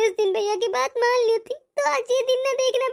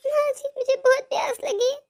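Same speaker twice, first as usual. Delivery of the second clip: normal talking voice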